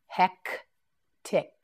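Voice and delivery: Hectic stretched slowly, each sound held; slow drawn-out word. Shown as a demonstration. Teacher modelling Hectic for learners, slowly stretched. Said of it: "teacher models new word".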